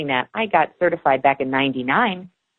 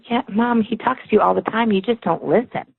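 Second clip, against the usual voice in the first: put-on higher, matter-of-fact kid telling mom off; a hint of a know-it-all boy; child voice; 7 semitones higher than usual. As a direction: son gently scolding his mom, higher kid voice. Son scolding mom lightly.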